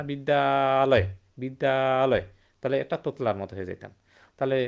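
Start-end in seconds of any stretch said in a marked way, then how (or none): 0.0-2.2 বিদ্যালয় said slow and loud as one smooth word; drawn out, louder, flowing বিদ্যালয়; smooth emphatic demo. Shown as a demonstration. Teacher showing the smooth joined word, slow and loud. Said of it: "তোতলার মতো না".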